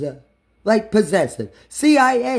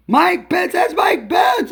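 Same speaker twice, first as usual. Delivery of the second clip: excited recognition; 9 semitones higher, slower than usual. Real, not acted.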